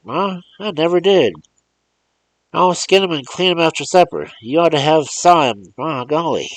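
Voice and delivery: put-on high, loud, bursting with boyish pride; a thrilled boy gushing over his dog; excited country boy. Boy showing his mother the rabbits, excitedly loud and high.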